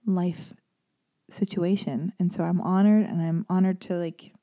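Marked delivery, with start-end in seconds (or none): none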